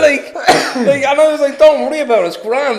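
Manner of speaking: high and loud through laughter; laughing. Real, not acted. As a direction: speak while still laughing.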